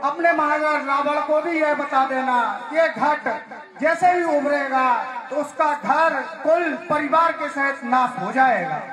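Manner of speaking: declaimed prophecy of ruin; sage pronouncing doom. Acted. Sage warns Ravan's envoys of his family's ruin, declaimed warning. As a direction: deliver the curse as a public warning.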